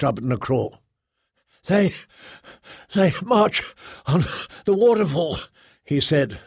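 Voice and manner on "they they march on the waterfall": winded, higher-pitched, broken by gasps; breathing heavily. A narrator's reading. Winded mate reports the enemy's march, breathing heavily. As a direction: pant between words, out of breath from running. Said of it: "still breathing heavily".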